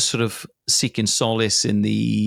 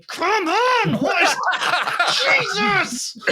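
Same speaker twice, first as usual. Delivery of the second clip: exasperated inner yell; slower than usual. Retold or imitated. Inner panic at his squeaky voice.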